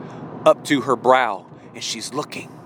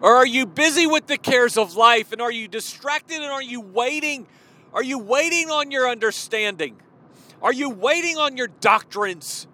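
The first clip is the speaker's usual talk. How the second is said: higher, driving rhetorical questions; fervent preaching; 7 semitones higher than usual. Real, not acted. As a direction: preach the call, question after question. Preaching at full force.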